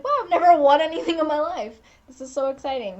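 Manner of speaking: high, fast, thrilled; excited. Retold or imitated. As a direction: gush like you've never won anything. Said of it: "I was so excited".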